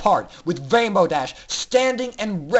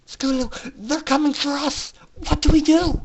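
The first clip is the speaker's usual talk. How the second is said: panicked filly; 8 semitones higher than usual. A narrator's reading. Aurora to Scootaloo as the suits close in, panicked. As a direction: plead for a plan in fear.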